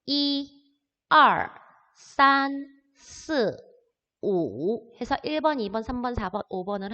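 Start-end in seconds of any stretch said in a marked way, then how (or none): 0.0-5.0 Chinese count 이, 알, 산, 스, 오, each tone exaggerated and separate; imitated listening track. Teacher mimicking the original listening track, Chinese numbers spaced out with tones. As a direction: count 이, 알, 산, 스, 오 one by one with pauses, like a slow language tape. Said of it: "원래 듣기를 듣게 되면은요".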